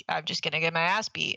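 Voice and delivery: ordinary talk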